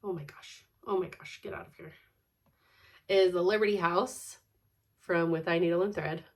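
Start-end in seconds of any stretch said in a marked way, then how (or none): none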